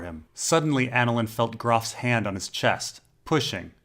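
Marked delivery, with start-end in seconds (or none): none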